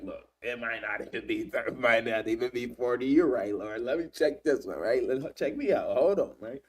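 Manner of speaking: a hint of a street-smart haggler; bargaining Abraham.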